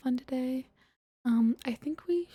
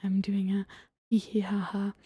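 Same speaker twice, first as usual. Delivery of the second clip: usual speaking voice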